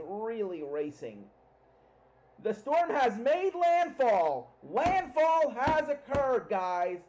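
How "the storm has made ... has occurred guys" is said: loud, slow, excited announcement; yelling over hurricane winds. Real, not acted. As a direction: call it out big and slow.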